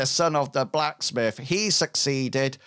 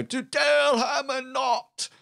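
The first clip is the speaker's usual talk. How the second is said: tavern toast; 12 semitones higher than usual. Acted. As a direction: raise a tankard to fallen heroes. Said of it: "And they go".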